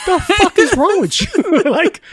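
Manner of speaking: high, slow, laughing outrage; can't believe what he's hearing; laughing disbelief. Real, not acted. Disbelief at red wine order.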